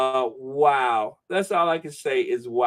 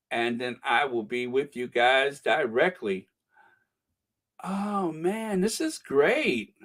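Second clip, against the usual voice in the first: normal talking voice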